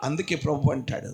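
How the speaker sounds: usual speaking voice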